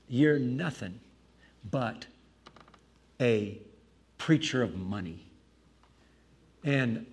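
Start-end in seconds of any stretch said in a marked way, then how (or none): 0.1-5.2 low, halting delivery with long pauses; measured, retold accusation; retold grave accusation. Upset host's deliberate accusation.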